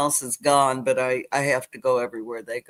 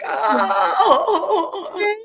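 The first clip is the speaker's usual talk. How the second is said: high, laughing gasp of surprise; a little girl's overwhelmed "oh"; stunned delight; 4 dB louder than usual. Retold or imitated. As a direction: melt into happy disbelief. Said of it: "And I'm like".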